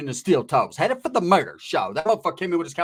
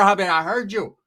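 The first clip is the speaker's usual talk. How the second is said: normal talking voice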